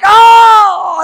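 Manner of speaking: very high, loud startled shout; jolted awake by sudden light; startled kid's cry. Retold or imitated. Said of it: "And I'd be like".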